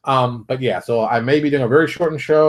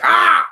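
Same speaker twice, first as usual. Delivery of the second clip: sudden high yelp of a pretend heart attack; hammed-up heart attack cry; mock heart attack scream; 6 dB louder than usual. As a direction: shriek once as if struck by a heart attack. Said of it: "I'm gonna be like".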